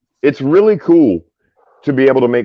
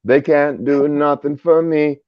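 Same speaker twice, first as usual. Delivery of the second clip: higher, slow, put-on comedic voice; mock Eddie Murphy rant; 6 semitones higher, slower than usual. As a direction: ham up a comic's rant, slow and high. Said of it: "and he was like".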